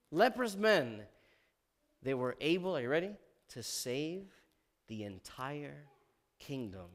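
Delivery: usual speaking voice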